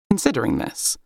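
plain reading voice

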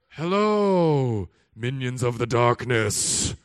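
loud, low, slow villain voice; mock sinister greeting. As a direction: play the dark overlord greeting his minions. Pastor mock reply to drunks.

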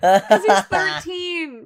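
laughing emphatic delivery; emphatic punchline. Real, not acted. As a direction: land the joke hard.

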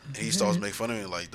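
ordinary delivery

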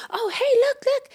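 very high put-on mocking voice; the talkative guy. Rival in the queue spotting a beer line, high mock voice. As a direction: imitate him in a high falsetto. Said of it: "the one guy who's doing all the talking goes".

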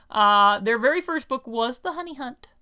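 ordinary talk, nothing marked

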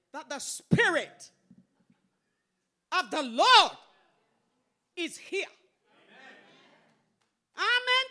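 loud, high, drawn-out proclamation to a congregation; real fervent pulpit cry. Real, not acted. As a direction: preach it out at full volume, stretching key words. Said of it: "raising my voice".

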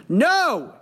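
high-pitched mock room scream; whole room screaming answer to the boss. A narrator's reading. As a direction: throw it out at full pitch. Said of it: "the entire room screamed".

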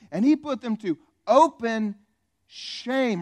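triumphant, loud scripture read; loud proclaiming. Shown as a demonstration. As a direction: ring out "open shame" to the room. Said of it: "Are you kidding me?".

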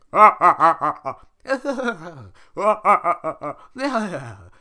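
big hearty acted guffaw; loud ogre laugh. Shrek and Donkey laugh.

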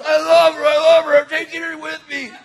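high, loud imitation of a raving man; mock frantic yelling. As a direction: yell it while shaking someone. Acts out the shaking man's yelling.

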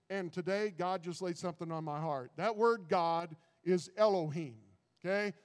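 usual speaking voice

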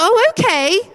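retold jolt of surprise; taken aback. Reader discovers what the psalm really says, taken aback. Reacting to reading the whole psalm.

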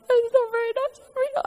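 high, tearful pleading to God; crying to God. Retold or imitated. Runner telling God about the dogs, crying and high-pitched. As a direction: let the voice climb high and tearful. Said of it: "I'm crying".

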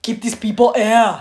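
slow, loud mock Austrian English; Arnold accent imitation. Imitating Arnold's English for the co-host, loud and slow. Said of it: "mä yritin merkeä äsken sitä imitoida".

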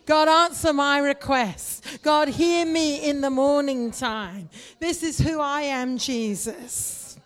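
loud crying out to God; shouted prayer. Real, not acted. Pastor crying out to God in a loud shout. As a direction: call out with full force.

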